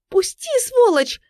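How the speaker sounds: very high, loud shriek; frantic squeal. A narrator's reading. Woman struggling with her attacker, shrieking frantically. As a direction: scream to be let go. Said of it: "истошно визжала женщина".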